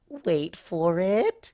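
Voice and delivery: drawn out very slowly, high; slow teasing. Acted. Pinkie teases Rarity about the card, very slow. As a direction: stretch each word to build suspense.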